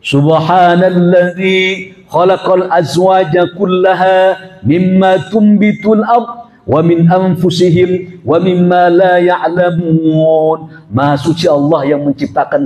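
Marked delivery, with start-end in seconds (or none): 0.0-10.9 slow, chanted Arabic verse; Quran recitation. Acted. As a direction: recite it slowly like a qari.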